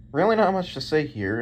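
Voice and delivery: ordinary delivery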